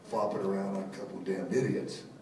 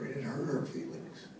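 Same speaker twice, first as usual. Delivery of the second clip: ordinary talk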